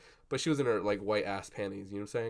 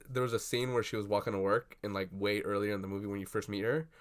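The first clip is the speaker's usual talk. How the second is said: ordinary talk, nothing marked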